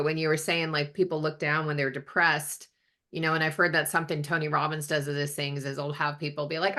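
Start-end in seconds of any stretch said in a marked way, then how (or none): none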